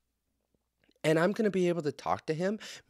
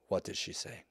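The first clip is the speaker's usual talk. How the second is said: whispered Putin; 7 semitones lower, 10 dB quieter than usual. Retold or imitated. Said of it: "Putin would be like".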